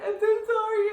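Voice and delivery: very high, loud retold apology; apologetic wail. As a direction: squeal out a desperate mock apology. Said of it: "I apologized".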